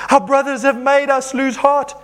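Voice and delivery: high, emphatic wail of reading; dramatic complaint. Acted. Preacher reading the Israelites' complaint, high dramatic protest.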